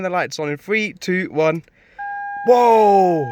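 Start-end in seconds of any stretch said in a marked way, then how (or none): 2.5-3.2 loud, high astonished gasp; amazed whoa. Real, not acted. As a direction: wow at the sudden lights. Lights turned on in dark.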